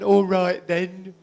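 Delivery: a hint of a sly small boy; slow child voice.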